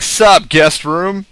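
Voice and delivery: slow, high, loud mock greeting; casual greeting, acted. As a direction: drawl a hammed-up hello, loudly. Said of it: "Be like".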